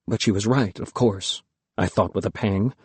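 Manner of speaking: ordinary delivery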